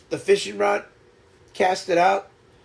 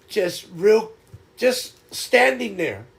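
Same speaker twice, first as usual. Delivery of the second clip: normal talking voice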